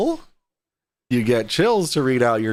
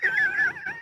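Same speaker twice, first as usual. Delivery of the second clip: shrill laugh; 5 dB quieter than usual. Shown as a demonstration. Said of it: "my laugh sounds more like this".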